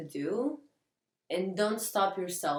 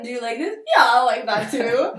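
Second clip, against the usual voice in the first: eager answers; 6 semitones higher, 13 dB louder than usual. Retold or imitated. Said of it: "And then".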